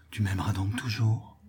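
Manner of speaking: soft unvoiced whisper of a lover; hushed, tender whisper. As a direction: breathe the question with no voice, intimate. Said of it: "reprit tout bas".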